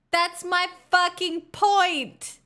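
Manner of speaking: exasperated outburst. Real, not acted. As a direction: hammer the point home in frustration. Exasperated reply in chat debate.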